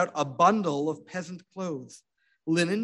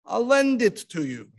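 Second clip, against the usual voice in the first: higher, louder hearty offer; peasant offer; 7 semitones higher, 5 dB louder than usual. A narrator's reading. Disguised rabbi reassuring the poor widow, hearty.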